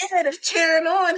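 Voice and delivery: high-pitched protester imitation; mock agitated protester. Protester telling a passerby about the cheering, mock agitated.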